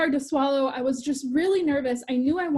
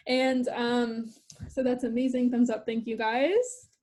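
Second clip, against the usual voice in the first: normal talking voice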